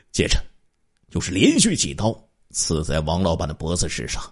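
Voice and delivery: ordinary delivery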